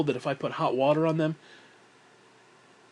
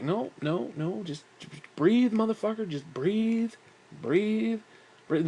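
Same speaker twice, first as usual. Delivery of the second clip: retold panic self-talk; 6 semitones higher, slower than usual. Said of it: "start to have the fucking panic attack".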